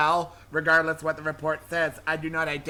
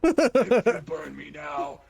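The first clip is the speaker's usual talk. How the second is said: demonic threat; 5 semitones lower than usual. Acted. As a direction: warn them like a trapped demon. Demon threatens the one burning it.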